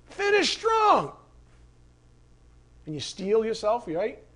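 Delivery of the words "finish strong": much higher, slow drawn-out shout; coach shout. Retold or imitated. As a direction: call it out to push the runner home. You hear, Coach.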